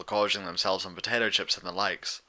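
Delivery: usual speaking voice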